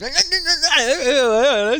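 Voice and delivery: high squawking cartoon chick. Player mimicking little chicks fighting in cartoons, high squawk. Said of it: "les petits poulets dans les dessins animés".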